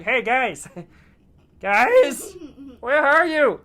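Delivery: high, loud robber calling for his buddies. Acted. Robber finding his buddies gone, high worried call. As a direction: call out, rising into hammed-up worry.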